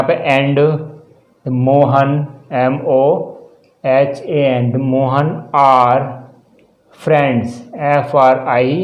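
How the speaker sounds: drawn-out dictation pace; slow dictation. Shown as a demonstration. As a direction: dictate slowly so students can write. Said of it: "teacher dictating the sentence". (धीरे-धीरे लिखवाना)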